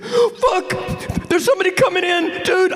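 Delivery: very high yelped mock terror; high terrified yelp; shrieking panic. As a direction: shriek it fast like he's caught. Friend hears door open, panics.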